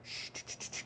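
soft, repeated shushes; urgent quiet shushing; hushing. Retold or imitated. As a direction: hush a crying child quickly. Hushing his crying son.

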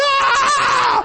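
high laughing shout "ah"; overwhelmed driver shrieking with laughter; laughing scream. Retold or imitated. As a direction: burst out with a laughing yell. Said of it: "I was just screaming".

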